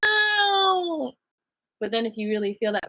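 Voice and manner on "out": high, loud, slow whiny sob; upset crying. Acted. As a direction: wail it like a hurt partner. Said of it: "they might be like".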